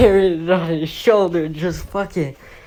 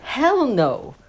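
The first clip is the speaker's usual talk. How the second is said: higher, scornful refusal; disgusted refusal; 8 semitones higher than usual. Retold or imitated. Hostage taker rejects melted candy, higher scornful voice. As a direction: turn it down with disgust. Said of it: "the guy who looks at him like".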